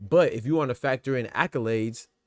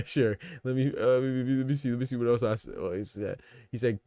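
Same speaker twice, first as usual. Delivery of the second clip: ordinary delivery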